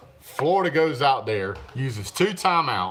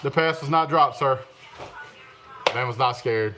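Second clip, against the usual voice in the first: ordinary talk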